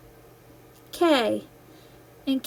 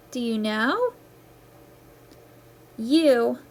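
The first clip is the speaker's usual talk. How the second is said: usual speaking voice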